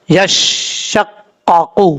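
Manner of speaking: very slow یشققو, syllables joined; slow joined reading. Shown as a demonstration. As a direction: draw یشققو out, linking each syllable. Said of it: "قاف پیش قو".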